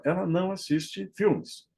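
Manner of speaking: normal talking voice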